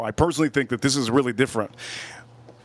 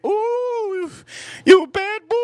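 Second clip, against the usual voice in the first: high breathy flirting coo; flirty woman voice; 4 dB louder, slower than usual. Retold or imitated. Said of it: "expecting women to come at you and say".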